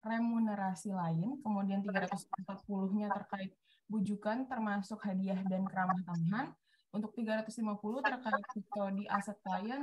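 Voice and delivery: choppy, distant call audio; breaking up space radio. Real, not acted. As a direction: voice cutting in and out, far away. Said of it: "Putus-putus dan agak jauh tadi suaranya". (suara putus-putus dan jauh)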